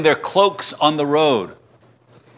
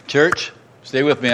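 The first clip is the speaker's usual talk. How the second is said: usual speaking voice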